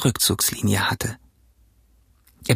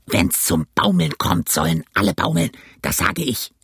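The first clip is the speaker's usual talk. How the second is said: angry captain; 5 semitones lower than usual. Acted. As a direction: growl it as a defiant old sea captain. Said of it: "schrie er einmal, und ein anderes Mal". (tiefe, raue, drohende Kapitänsstimme)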